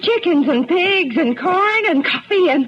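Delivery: breathless high gushing over the gifts; swooning "my gracious", climbing high; gushing delight. Acted. Widow gushing to Mister Bass over his gifts, high and delighted.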